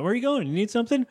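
high, anxious retold panic; mock freaking out. Retelling Kevin panicking as Tina leaves, high-pitched. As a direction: pitch it way up like a fretting kid. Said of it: "Kevin's all, like, freaking out".

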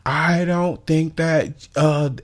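high, slow whiny complaint; mock whiny customer. Imitating the entitled customer whining about being late. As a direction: whine like the entitled lady. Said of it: "she was like".

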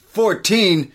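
high, offended Fourteen?; indignant correction. Retold or imitated. Han scoffing at the wrong number, high indignant. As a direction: toss off Fourteen? like it's an insult.

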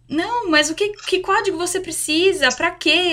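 a market worker who doesn't understand "pin"; confused cashier. Retold or imitated. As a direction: ask what code she could possibly want.

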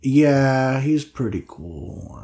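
ordinary delivery

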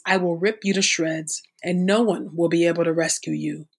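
ordinary talk

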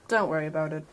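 ordinary delivery

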